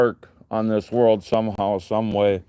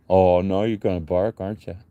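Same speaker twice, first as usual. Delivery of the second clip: ordinary delivery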